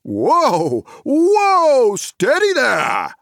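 high, loud booming call; loud hearty whoa; cried out. Acted. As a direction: halt them with a jolly shout. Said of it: "cried the old man".

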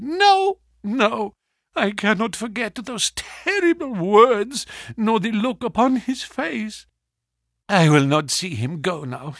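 wife turning away her husband's friend, high and anguished; distraught wife. Acted. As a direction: refuse him, then plead for the child. Wife refuses to see husband.

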